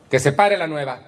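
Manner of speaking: imitating a tactless meeting leader; unpleasant commanding tone. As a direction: order the newcomer up sharply. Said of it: "su pinche tono de voz".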